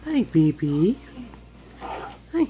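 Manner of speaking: whispering. Real, not acted. Greeting the baby softly.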